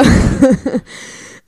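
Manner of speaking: loud, high, breathy burst; real laughter. Real, not acted. Playwright laughing at her plan to make money, amused.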